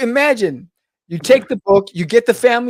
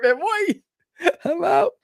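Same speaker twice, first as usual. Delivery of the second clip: whiny high-pitched mock cry; 8 semitones higher, slower than usual. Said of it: "She's like".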